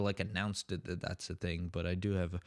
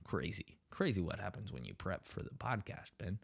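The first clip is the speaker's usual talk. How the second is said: sarcastic mutter; 3 semitones lower, 8 dB quieter than usual. Acted. Sarcastic self-praise.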